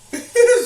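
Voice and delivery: quick high giggle; short laugh. Real, not acted. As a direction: giggle at your own slip. Laughs at slip of tongue.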